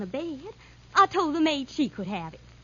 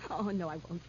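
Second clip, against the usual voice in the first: low, very soft, placating; cowed denial; 8 semitones lower, 10 dB quieter, faster than usual. Acted. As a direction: back down in a small frightened voice. Backs down after threat.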